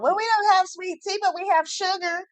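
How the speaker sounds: high, prim mock server voice; mocking a West Coast server with sugar packets; mocking waitress. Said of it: "Tell me".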